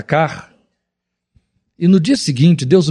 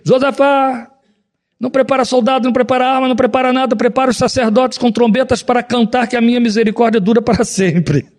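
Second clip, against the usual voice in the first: raised, proclaiming prophet's message, higher pitch; 8 semitones higher than usual. Acted. Preacher voicing a prophet to King Jehoshaphat, higher proclaiming. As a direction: call out God's word like a prophet. God speaking through the prophet.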